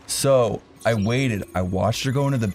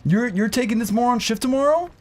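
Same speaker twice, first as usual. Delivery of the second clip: mocking manager; 9 semitones higher than usual. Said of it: "She's like".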